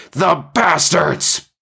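high, drawn-out howl of outrage; shouted. A narrator's reading. Pilot under gunfire cursing the attackers, shouting. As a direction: cry out high, stretching the curse. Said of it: "Paul shouted".

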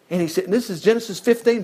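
normal talking voice

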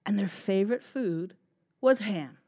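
put-on lively, animated read-aloud for kids; storytime narration. A narrator's reading. Librarian reading a story to children, animated. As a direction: tell it big and bright for little listeners.

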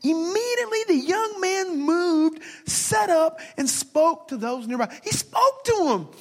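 high, rising excitement; excited. Real, not acted. Pastor marveling at the risen son, high excited.

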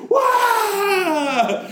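high shouted scare cry; scare yell. Pretend. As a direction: leap out with a high shriek. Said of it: "He's like".

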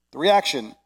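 usual speaking voice

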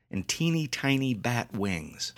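ordinary delivery, nothing marked

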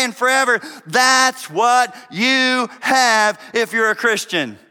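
loud, high-pitched impassioned sermon; exultant, emphatic proclamation; full-force preaching. Real, not acted. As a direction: preach it with rising fervor, driving each phrase home.

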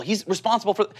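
ordinary delivery

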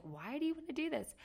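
high-pitched baffled question; incredulous husband. Retold or imitated. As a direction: imitate a husband's disbelief, pitch jumping up. Husband questions her decision.

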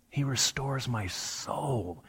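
hushed, slow, landing on a long soul; near-whisper the phrase and draw out soul; stress on soul. Shown as a demonstration. Preacher repeating the passage, soul stressed in a hush. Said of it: "repeating the passage and emphasizing different words".

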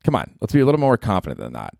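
ordinary talk

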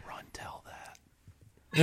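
hushed, menacing-joke whisper. Pretend. Attacker to the one guard left alive, soft whisper. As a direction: whisper it like a parting message. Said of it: "You just whisper to the one".

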